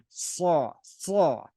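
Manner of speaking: saw said with a throaty ayin and S together; saw, guttural S from the back of the throat; old guttural saw. Shown as a demonstration. Teacher demos the old guttural saw. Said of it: "pronounced with a ah, ayin, and S".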